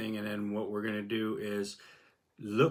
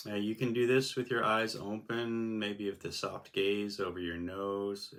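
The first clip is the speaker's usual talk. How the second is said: guided meditation; 2 semitones lower, 3 dB quieter than usual. Acted. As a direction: ease the listener gently into stillness. Begins guiding the meditation.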